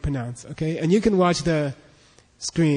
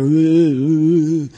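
droning 'Ooh' from the nose; mimicked French nasal noise; 4 dB louder, much slower than usual. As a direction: imitate Parisians honking through the nose. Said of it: "everybody's going".

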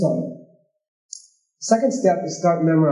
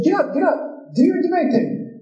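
much higher, insistent get up, get up; high urging call; 12 semitones higher than usual. Retold or imitated. Monks urging the newcomer to stand and debate, high insistent call. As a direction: push the newcomer up in a high excited voice. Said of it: "And then they said".

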